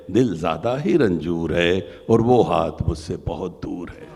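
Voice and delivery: loud declaiming poem voice; stately nazm recitation. Acted. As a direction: declaim the lines with grief.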